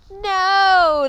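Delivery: high, loud crowd refusal; yelling. A narrator's reading. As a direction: holler it as one crowd. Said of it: "the crowd yelled".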